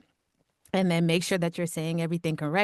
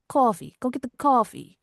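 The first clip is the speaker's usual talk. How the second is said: higher, louder Long Island accent; 7 semitones higher, 6 dB louder than usual. Shown as a demonstration. Guest showing the little local accent, playful Long Island voice. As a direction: lean into a Long Island "coffee". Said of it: "Long Island, sometimes there's, like, a little, little accent".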